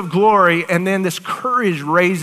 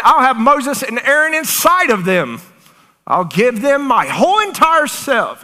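higher and louder, ringing out; fervent proclamation; 7 semitones higher, 4 dB louder than usual. Acted. Preacher voicing the Lord to the church, fervent and loud. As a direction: proclaim it as the Lord's promise. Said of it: "and he's like".

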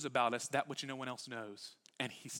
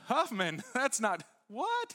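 incredulous; 10 semitones higher, 7 dB louder, slower than usual. Retold or imitated. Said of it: "So it's like".

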